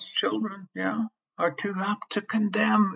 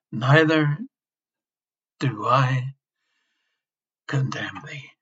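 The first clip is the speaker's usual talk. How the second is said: hushed tender quote; 5 semitones lower than usual. Acted. Quoting Jesus tenderly to sinner.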